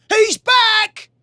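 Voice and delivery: excited shout. Acted. Palace guard announcing the warrior's return, shouting. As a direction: shout the news across the palace. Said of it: "a palace guard shouted".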